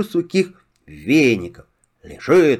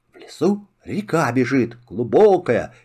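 ordinary delivery, nothing marked

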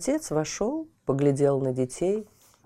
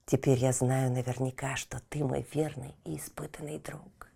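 soft whisper; 3 semitones lower, 6 dB quieter than usual. Acted. Boy whispers to dog.

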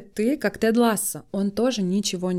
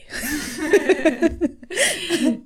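breathy laugh; 9 semitones higher, 3 dB louder than usual. Real, not acted. Laughs after own joke.

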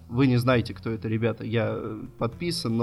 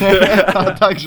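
loud burst of laughter mid-word; laughing; 7 semitones higher, 10 dB louder, much slower than usual. Real, not acted. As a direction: break up laughing. Laughing over intro.

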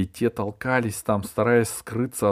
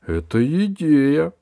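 higher, louder delighted cry; excited exclamation; 8 semitones higher, 7 dB louder than usual. A narrator's reading. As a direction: jump up delighted. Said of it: "воскликнул Герасик".

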